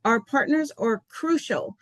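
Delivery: ordinary delivery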